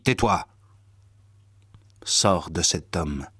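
ordinary delivery, nothing marked

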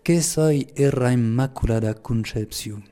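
slow, measured Gascon patois; Pyrenean patois. Shown as a demonstration. Priest quotes Mary's enigmatic phrase in slow patois. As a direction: pronounce it slowly in Lourdes patois. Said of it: "je vais le dire en patois".

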